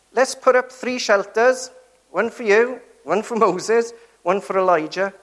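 excitedly listing the three shelters; eager Peter. Retold or imitated. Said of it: "what does he say".

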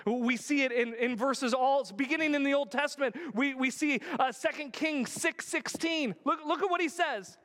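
high, loud building sermon; impassioned preaching. Real, not acted. Pastor pressing the point to his congregation, loud and high. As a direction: preach it with rising urgency.

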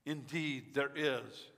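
ordinary talk, nothing marked

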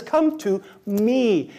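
slow, tender "Come to me." stretched out; personal invitation. Shown as a demonstration. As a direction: invite one person slowly and kindly. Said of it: "It's personal".

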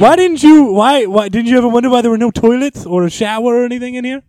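high, loud indignant questioning; exasperated demand. Acted. Homeowner scolding guests who used the atrium, loudly exasperated. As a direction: stammer in loud disbelief at their stupidity. Exasperated about bathroom mistake.

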